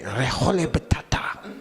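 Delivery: breathy Spanish-style "Riojole Bitata"; hushed Spanish accent on the name; whispered Spanish twang. Shown as a demonstration. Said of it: "say that with a little Spanish twang".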